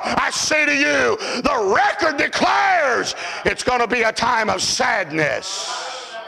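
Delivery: slow, stretched proclamation coming down from a shout; ringing sermon climax; declaiming preacher. Acted. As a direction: declare it solemnly, easing down at the end. Preaching at full force.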